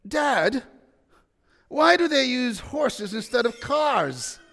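a hint of a curious four-year-old; little boy. Four-year-old Lukey asking his dad, lightly voiced child. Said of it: "in his little New Zealand accent".

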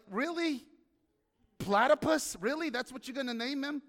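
high, rising "Platypus?" in incredulity; mock disbelief. Reacting to God naming the platypus, high incredulous. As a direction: question the choice, amazed.

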